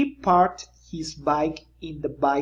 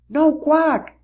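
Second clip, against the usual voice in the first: Splat insisting; 10 semitones higher, 7 dB louder than usual. A narrator's reading. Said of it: "said Splat".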